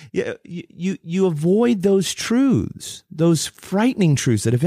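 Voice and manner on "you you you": normal talking voice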